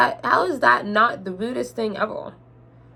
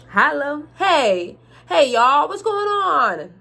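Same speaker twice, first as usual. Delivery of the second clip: high, drawn-out country holler; country accent; 6 semitones higher, 3 dB louder, much slower than usual. Acted. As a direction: try a hammed-up country greeting. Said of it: "that's not a country accent".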